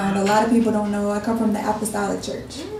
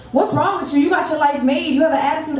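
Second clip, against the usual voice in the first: higher-pitched mock challenge; retold confrontation, pitched up; customer with attitude; 6 semitones higher than usual. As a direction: imitate a customer calling someone out. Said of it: "customer got an attitude with me".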